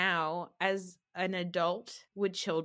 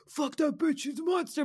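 mock angry phone rant, pitched up; Evan; 6 semitones higher, 3 dB louder than usual. Husband on the phone about wife's enemy, mock outrage. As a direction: imitate a husband ranting, higher than usual. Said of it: "Even Evan on the phone".

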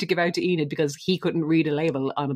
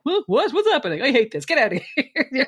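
much higher, louder, flustered and mocking Todd; 8 semitones higher, 3 dB louder than usual. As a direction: play Todd confused and snapping. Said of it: "He's just like".

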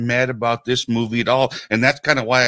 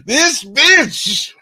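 This bitch, jumped way up high and loud; mock outraged squeal; 10 semitones higher, 5 dB louder than usual. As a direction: squeal it in fake indignation. Mock outrage at her fortune.

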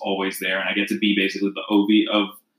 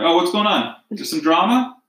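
mock nosy bystander; 8 semitones higher than usual. Imagines herself as idle bystander.

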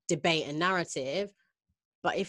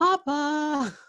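laughing; 10 semitones higher, 3 dB louder than usual. Real, not acted. Stumbling over the name, laughing.

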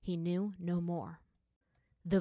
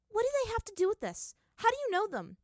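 high, fast, louder questioning; agitated; 5 dB louder, faster than usual. Acted. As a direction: challenge her defensively, rattled. Agitated questioning of hallucinated mother.

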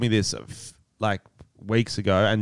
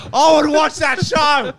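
loud, high, slow shout of delight; excited shout; 5 dB louder, slower than usual. Real, not acted. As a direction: belt it out with glee. Excited shout about show idea.

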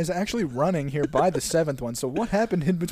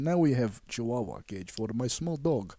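put-on deep-pitched Russian-accented captor; mock Russian accent; 5 semitones lower than usual. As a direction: lower comic Russian accent. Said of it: "now you have Russian accent".

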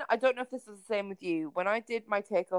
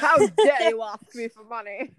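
slow, louder pretend indignation; mock outrage; 5 dB louder than usual. Imagined host parent refusing to pay, mock outrage. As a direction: play the offended boss, drawn out. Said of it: "They're not gonna yell at you".

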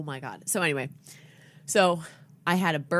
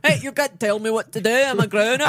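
put-on brash drunk grown-up; 3 semitones higher, 6 dB louder than usual. Drunk adult bit continues.

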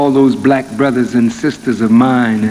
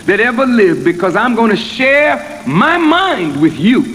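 higher, ringing preacher's voice building to a peak; full-force preaching; 5 semitones higher than usual. Acted. Minister voicing God's plan to the congregation, rising preaching.

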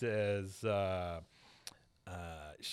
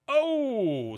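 high, loud hammed-up oh; mock surprise; 12 semitones higher, 8 dB louder than usual. Host voicing the star hearing Bobby's news, loud mock surprise. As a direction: gasp it out big. Star reacting in retold scene.

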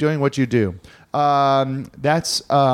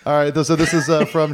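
ordinary talk, nothing marked

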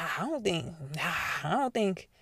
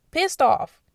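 loud emphasis; 7 semitones higher, 8 dB louder than usual. Real, not acted. Describing angry Republicans.

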